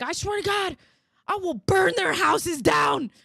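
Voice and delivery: high, loud hammed-up threats shouted; mock furious shout. Husband on hold yelling threats, high hammed-up shouting. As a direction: rage theatrically at the cable company.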